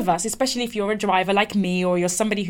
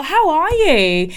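higher, louder, drawn-out HOW ARE YOU; gushing greeting; 6 semitones higher, 5 dB louder, much slower than usual. Retold or imitated. Colleague welcomes her into the office, gushing. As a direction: imitate a colleague's effusive hello.

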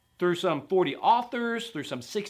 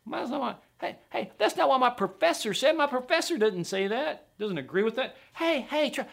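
higher-pitched mock objector; objecting sceptic; 6 semitones higher than usual. Sceptical student telling the preacher his professor disagrees, objecting. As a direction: butt in as a student citing a professor. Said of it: "Some hear that and they're like".

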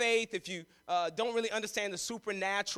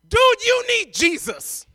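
brother's shout; 12 semitones higher, 12 dB louder, slower than usual. Retold or imitated. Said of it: "It's like".

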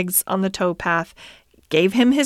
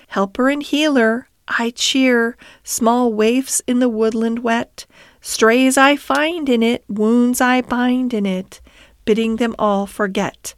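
verse recitation; 3 semitones higher than usual. Acted. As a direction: recite it as a mysterious song in verse. Rat recites the song's words.

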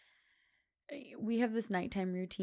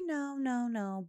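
put-on child's refusal; 6 semitones higher, much slower than usual. Voicing daughter refusing.

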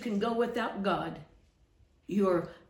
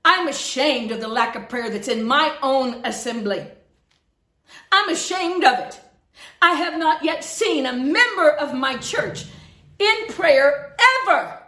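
loud, high rebuke of the congregation; raised-voice pulpit reproach; indignant preaching; 3 semitones higher, 7 dB louder than usual. Real, not acted. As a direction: preach it hotly, climbing to a forceful end. Preaching at full force.

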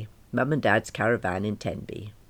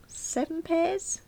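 doubtful wondering; 4 dB quieter, slower than usual. Acted. Mother musing over shopping list.

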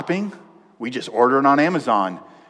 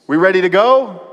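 husband asks wife if she's ready, calling out higher and louder; raised, higher call to hurry someone along; 5 semitones higher, 5 dB louder than usual. Acted. Husband waiting on his wife, impatient call.

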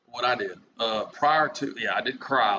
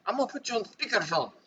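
put-on high, softer caricatured Minnesotan accent; mock Vietnamese-Minnesotan accent; 10 semitones higher, 5 dB quieter than usual. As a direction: imitate the woman in a high, muddled Minnesotan accent. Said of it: "turned her into a Vietnamese Minnesotian".

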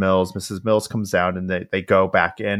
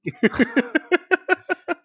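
a long, high cackling laugh; high burst of laughter. Real, not acted. Host laughs hard at her own divorce joke, high and long.

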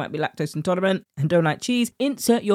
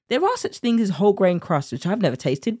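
normal talking voice

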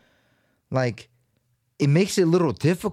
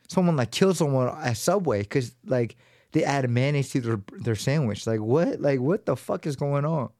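ordinary delivery

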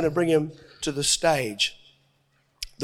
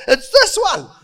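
very high, loud comic insistence; squeaky-high acted protest; hammed-up insistence; 13 semitones higher, 8 dB louder than usual. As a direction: insist at the top of your voice, half laughing.

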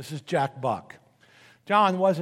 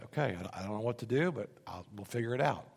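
hushed, moved; 6 semitones lower, 7 dB quieter, faster than usual. Retold or imitated. Jack Buck answering, moved, retold.

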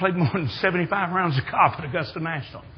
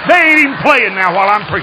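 high, loud pulpit proclamation; full-force preaching; 8 semitones higher, 8 dB louder than usual. Real, not acted. Preacher tells how God washed out the tournament, full-force preaching. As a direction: belt it out high to the whole congregation.

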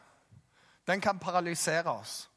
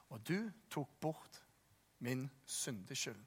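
scripture reading; 3 semitones lower, 5 dB quieter than usual. Acted. Preacher reading Psalm 32 to the congregation, softly.

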